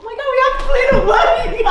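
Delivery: high, loud, fast and laughing; excited outburst. Real, not acted. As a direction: burst out with sudden real excitement. Realizes she can plan a wedding.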